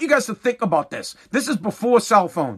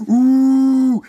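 mock ooh; 5 semitones higher, 6 dB louder than usual. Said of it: "the manager's like".

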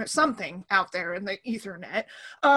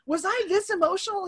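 higher-pitched incredulous questioning; retold disbelief; 5 semitones higher than usual. Mom phoning her dad about daughter's tears, incredulous. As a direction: voice climbs as you question it.

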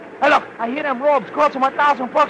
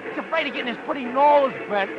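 ordinary talk, nothing marked

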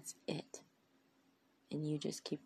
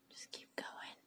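whispered; 10 dB quieter than usual. Real, not acted. Slow whispered encouragement.

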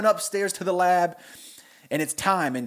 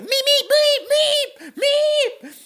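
very high, louder frantic meeps; panicked Beaker meep; 8 dB louder, faster than usual. Retold or imitated. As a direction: squeal meeps in panic at the fire. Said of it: "he freaks out".

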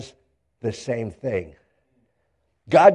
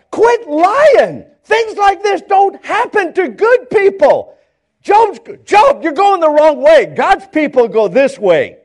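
high, loud mock rebuke; acted indignant telling-off; scolding accuser; 10 semitones higher, 5 dB louder than usual. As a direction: scold him at raised pitch. Acts out friends rebuking Job.

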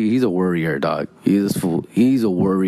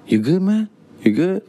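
higher, concerned check-in voice; brother-in-law asking; 10 semitones higher than usual. Retold or imitated. Brother-in-law checks on the sweaty, shaky narrator, higher and concerned.

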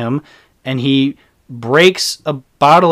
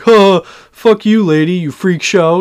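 higher, louder sneering jeer; mock bully jeer; 4 semitones higher, 5 dB louder than usual. Reviewer mimicking the bullies taunting Laurie, loud mocking jeer. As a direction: sneer it like a cruel teenage bully. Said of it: "is just like".

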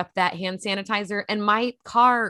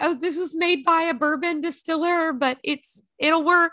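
dramatic gasp; 6 semitones higher than usual. Retold or imitated. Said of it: "my mom and I both were like".

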